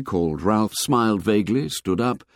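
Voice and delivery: plain reading voice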